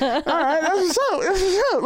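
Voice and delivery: very high squeaky voice, close to laughing; giddy mock approval. His retold reaction to ladies' attention.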